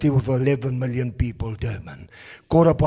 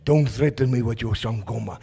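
ordinary talk, nothing marked

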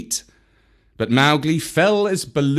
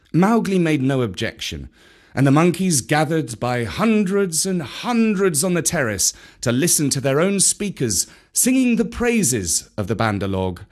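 ordinary delivery, nothing marked

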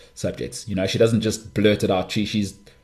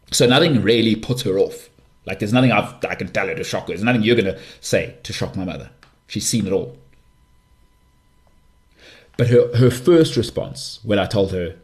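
ordinary talk, nothing marked

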